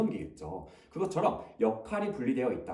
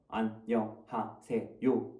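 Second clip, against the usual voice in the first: slow, each syllable cut apart; staccato; 4 semitones lower, much slower than usual. Shown as a demonstration. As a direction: say it one syllable at a time, choppy. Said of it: "그걸 한 글자씩 끊어서 말하면".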